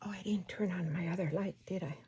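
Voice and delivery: quick whispered realisation; fast whisper. Real, not acted. Crafter noticing the light is off, quick whisper. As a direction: rush it under your breath.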